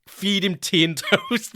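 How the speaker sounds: laughing while quoting. Retold or imitated. Dad's parting order, laughing.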